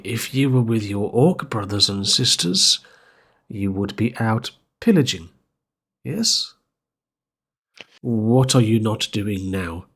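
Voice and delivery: usual speaking voice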